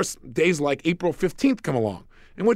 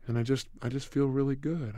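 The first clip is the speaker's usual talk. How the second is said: soft, low, relaxed voice; a settled, dreamy calm; peaceful tone; 4 semitones lower, 8 dB quieter than usual. Retold or imitated. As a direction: ease into calm, unhurried. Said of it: "talk to myself in this tone of voice".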